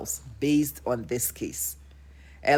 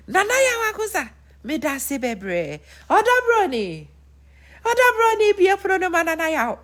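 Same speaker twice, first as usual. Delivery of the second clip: much higher, sing-song taunting; mocking Lumba; 11 semitones higher than usual. Lumba taunting Nana Yaw, high sing-song. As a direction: taunt Nana with lofty thanks. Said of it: "And so he would have told Nana".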